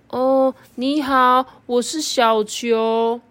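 very slow, high dreamy voice; drawn-out sleepy little bug; slow dreamy caterpillar. Acted. Second caterpillar answers slowly.

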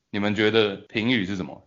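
normal talking voice

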